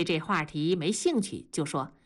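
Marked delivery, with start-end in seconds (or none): none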